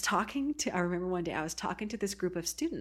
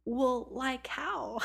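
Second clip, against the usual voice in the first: slow, exaggerated high Valley Girl drawl; mocking Valley Girl; 6 semitones higher, much slower than usual. Texas girl mimicking the Oregon teen, exaggerated Valley Girl accent. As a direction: parrot it back, stretching the West Coast vowels. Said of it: "Valley Girl surfer thing going on".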